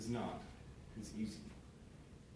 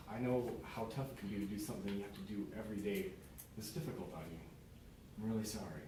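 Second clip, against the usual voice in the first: much softer, low soothing sympathy; tender comfort; 4 semitones lower, 7 dB quieter than usual. Acted. Parent comforting a struggling child, soft and tender. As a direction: gently hold their pain. Acted empathetic reply, soft.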